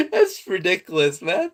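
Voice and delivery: high-pitched words through laughter; laughing hard. Real, not acted. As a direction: squeeze it out between real laughs. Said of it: "I haven't laughed like this".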